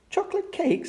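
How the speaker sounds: very high, astonished exclamation; startled storybook mother; surprised query. A narrator's reading. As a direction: voice shoots up in surprise. Mummy Pig finds an unexpected item.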